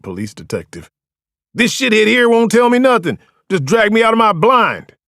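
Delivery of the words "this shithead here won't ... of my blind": loud high angry complaint; spitting mad. A narrator's reading. Cuffed Beach ranting at French, spitting mad. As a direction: rant at the detective. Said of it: "spitting mad".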